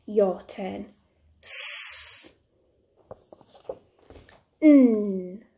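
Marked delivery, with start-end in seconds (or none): 1.4-2.3 S as a long voiceless hiss; phonics sound demo. Shown as a demonstration. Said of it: "say it after me". 4.6-5.4 N as a long hummed nnn; phonics sound demo. Shown as a demonstration. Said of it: "say it after me".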